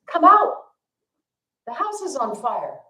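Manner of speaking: put-on a high call for the kids to come out; parents yelling. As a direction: parents calling the kids out of a burning house. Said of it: "they're yelling for the kids".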